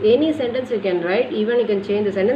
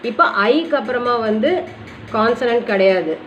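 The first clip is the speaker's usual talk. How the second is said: ordinary talk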